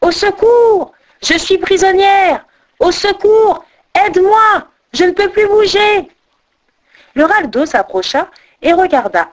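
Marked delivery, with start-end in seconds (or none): 0.0-6.1 high, loud panicked cries; urgent high-pitched calls for rescue; crying for help. A narrator's reading. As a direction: shout in acted alarm, trapped. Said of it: "elle se mit à crier".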